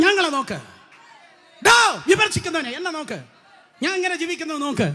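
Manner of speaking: defiant shouted challenge; challenging shout. Shown as a demonstration. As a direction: call it out, daring anyone to look. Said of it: "അത് വെല്ലുവിളിച്ച് പറയാൻ കഴിയണം".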